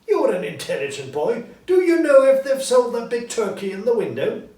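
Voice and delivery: put-on high, lively old miser turned joyful; high, eager old man calling a boy; cheerful old Scrooge. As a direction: play Scrooge bursting with glee. Narrator voicing Scrooge.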